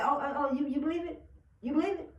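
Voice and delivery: high-pitched mocking questions; taunting doubter. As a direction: needle them with rising questions.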